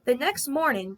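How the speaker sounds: ordinary delivery, nothing marked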